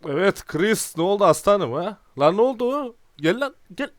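loud, high-pitched gloating shouts; triumphant taunting. Real, not acted. Gambler taunting Chris after a win, triumphant shouting. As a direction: crow loudly at the beaten rival, pitch climbing.

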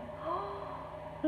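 soft, high intake of surprise; delighted gasp. Real, not acted. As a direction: gasp at something tiny and cute. Spots little envelopes.

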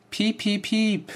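high mock beeps, piep piep; squeaky mock beeping for a funny recording; playful beeping. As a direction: chirp it like a toy. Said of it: "einfach witzige Sachen aufnehme".

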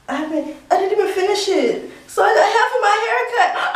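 high, loud cry climbing in pitch; tearful wailing. Real, not acted. Left with half a haircut, tearful wailing. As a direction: wail it, half laughing, half crying. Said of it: "Doing all this crying".

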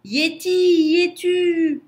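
high, loud, drawn-out shout. A narrator's reading. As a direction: yell across a great distance, stretching each word. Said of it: "Lou cria".

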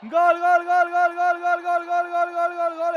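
rapid loud goal cry; breakneck goal commentary. Real, not acted. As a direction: fire off the goal call.

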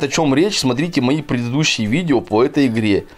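usual speaking voice

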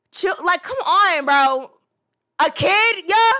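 loud, high exasperated plea; ranting. Real, not acted. Ranting viewer begs the YouTuber, loud. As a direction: throw up your hands and yell it. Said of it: "I gotta rant".

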